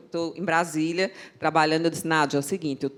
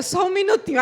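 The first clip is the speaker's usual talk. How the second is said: flustered hurry; 12 semitones higher, 7 dB louder than usual. Retold or imitated. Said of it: "Aí ela, aí ela".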